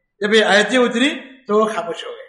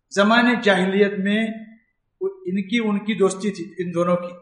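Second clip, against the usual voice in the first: usual speaking voice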